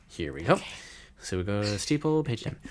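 normal talking voice

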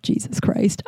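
breathy under-the-breath whisper; whispered aside. Real, not acted. Whispering Jesus Christ over dad's Victoria's Secret gifts. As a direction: drop to a whisper of disbelief.

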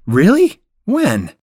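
high, loud disbelieving really; thrilled shock at big news; surprised. Acted. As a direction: leap up in pitch with surprise.

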